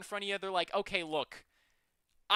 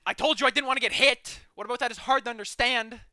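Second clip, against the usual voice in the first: loud exasperated shout; angry yell; 5 semitones higher, 8 dB louder than usual. Real, not acted. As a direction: yell at the boss, higher. Yelling at the boss.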